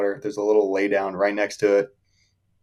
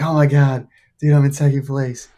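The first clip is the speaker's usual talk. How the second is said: retold elation, pitched higher; overjoyed; 5 semitones higher than usual. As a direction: relive the thrill of a great result. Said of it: "I was just so happy".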